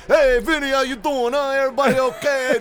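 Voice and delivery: brash goomba hello; loud goomba guy. Retold or imitated. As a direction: play the brash neighborhood guy. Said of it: "He got a little Goomba in him".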